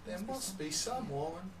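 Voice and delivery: half-hearted attempt at a French accent; bad French accent. Trying French while gaming, bad French accent. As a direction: put on a poor French accent. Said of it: "That wasn't a good French accent at all".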